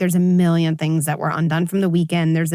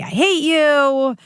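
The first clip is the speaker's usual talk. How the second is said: put-on slow, loud and higher, childlike outburst; angry kid; 8 semitones higher, 4 dB louder, much slower than usual. Son yelling back at his mother before running off. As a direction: throw it back like a hurt child. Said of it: "He then proceeded to say".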